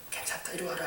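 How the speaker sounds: soft, gentle welcome; tender parent. Retold or imitated. Parent forgiving a child who came back, softly. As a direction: hush and draw the child close.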